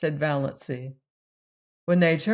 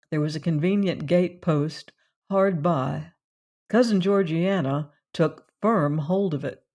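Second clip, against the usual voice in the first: ordinary delivery, nothing marked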